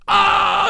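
a short high shouted "Ah!"; imitated crazy yell. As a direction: shriek it out wildly. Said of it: "and being like".

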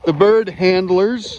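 slow, loud call-out; drawn-out announcement. Real, not acted. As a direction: announce them slowly and loudly. Announcing the bird handlers loudly.

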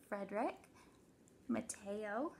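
ordinary delivery

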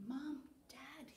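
put-on hushed child's whisper to sleeping parents. A narrator's reading. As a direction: whisper it as if waking someone gently. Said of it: "he whispered".

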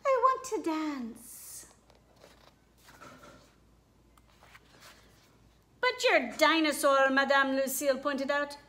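lively storybook read-aloud with character voices; reading to children. A narrator's reading. Teacher reading a picture book to her class, animated. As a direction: read it like story time for little kids.

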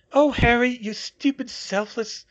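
high, pained character voice; Hermione's exasperated muttering. A narrator's reading. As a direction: begin loud and high, then ease off. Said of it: "she muttered".

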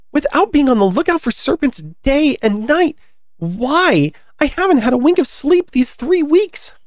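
high, harried bird character; high-pitched storybook grievance; aggrieved pigeon. Acted.

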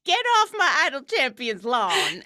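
put-on loud grumpy old-timer yell; cranky old man. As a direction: shout like a cranky neighbor. Cranky old-timer yelling at kids.

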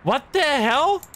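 sudden high, loud what the hell; jolted by the sound; startled outburst. Real, not acted. Gamer hearing the ghost recording, startled. Eerie audio clip plays.

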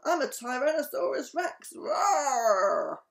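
high, loud playful dinosaur boast; pretend dinosaur. Bella plays Tyrannosaurus Rex for friends, roaring playfully. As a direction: build into a big mock roar.

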